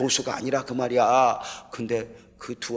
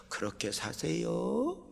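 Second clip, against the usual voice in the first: softer, slow, gentle urging; toned-down preaching; 7 semitones higher, 6 dB quieter, much slower than usual. Shown as a demonstration. Pastor showing his milder way of preaching now, gentle and slow. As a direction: ease off and say it gently. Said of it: "조금 낮춰서".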